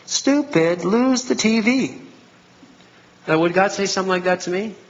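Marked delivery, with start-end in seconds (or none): 0.1-2.0 slow, scornful mock God voice, lightly done; mock harsh God. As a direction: play a contemptuous God snapping at a fool. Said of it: "And God goes".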